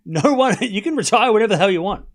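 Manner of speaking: high, loud, emphatic objection; indignant rebuttal. Real, not acted. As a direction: push back hard at the viewer. Rebuts chatter urging player's retirement.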